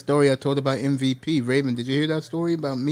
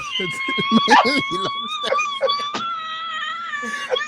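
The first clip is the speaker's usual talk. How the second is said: long, very high cackle; helpless high-pitched laughing fit; high laughter. Real, not acted. Storyteller remembering the ham story, long high laughter.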